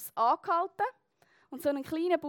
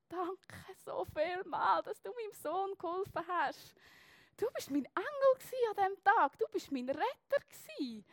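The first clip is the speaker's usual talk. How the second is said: higher, slow, moved mother's thanks; tearful thanks; 5 semitones higher, 4 dB quieter than usual. Retold or imitated. Mother at the bus stop thanking the student for helping her son, tearful and slow. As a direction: let the thanks come through held-back tears. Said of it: "Tränen in den Augen".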